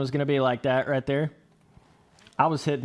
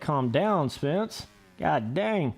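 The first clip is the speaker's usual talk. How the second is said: normal talking voice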